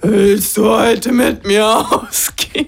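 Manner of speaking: overdriven, slow, shouted invitation; fuzz distortion. Pretend. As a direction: blast it into the mic until it clips. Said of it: "[name], du übersteuerst ein bisschen". (übersteuert und verzerrt gerufen)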